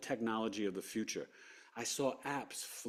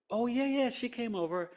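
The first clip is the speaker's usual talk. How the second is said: higher, breezy wife voice; lightly voiced wife; 12 semitones higher than usual. Wife admitting the visitor, higher and breezy. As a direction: answer brightly like the wife.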